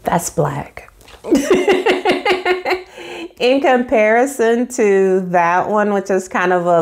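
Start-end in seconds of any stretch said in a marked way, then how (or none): 1.2-3.3 squealing giggle; high delighted laugh; giddy high laugh. Real, not acted. Delight at ink color.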